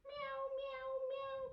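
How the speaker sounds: high playful meows; squeaky pretend cat meows; kitty meows. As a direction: meow like a little kitten. Cat pose again.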